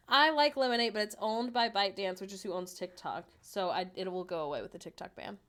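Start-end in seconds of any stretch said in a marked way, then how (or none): none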